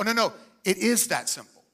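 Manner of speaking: usual speaking voice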